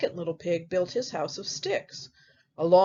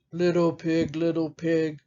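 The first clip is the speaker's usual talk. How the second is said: story wolf; 4 semitones lower than usual. Acted. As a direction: call through the door as a low-voiced wolf. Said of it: "knocks on the door and says".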